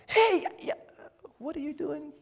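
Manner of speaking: very high, incredulous mock call; townspeople calling out. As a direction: imitate onlookers hailing someone in a high voice.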